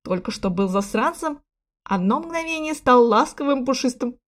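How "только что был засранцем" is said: usual speaking voice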